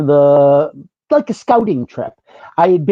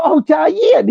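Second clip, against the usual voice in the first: higher, drawn sentimental Oh, Diahann; mock tender; 10 semitones higher than usual. Holding Diahann's hand at the movie, mock tender sigh. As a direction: ham up a touching moment. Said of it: "take Diane's hand and go".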